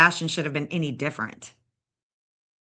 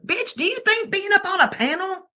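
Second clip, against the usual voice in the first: higher, indignant rant at Katie; talking back to Katie about the panel, indignant; heated retort; 7 semitones higher than usual. Real, not acted. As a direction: fire back, indignant and rising.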